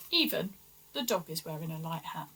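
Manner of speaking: quick soft comic aside to listeners. Acted. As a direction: toss it off under your breath.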